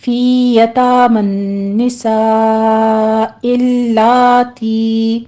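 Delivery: very slow chanted tajweed reading; measured Quran recitation, vowels held two counts; slow Quranic recitation. Shown as a demonstration. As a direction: recite it slowly as a model for students. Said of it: "دونوں جگہ فتح قائمہ ہے".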